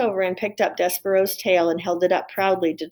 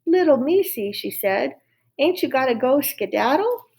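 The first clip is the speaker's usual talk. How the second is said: gentle Mig; 5 semitones higher than usual. Acted. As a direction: coax the little mouse away. Mig talks to mouse.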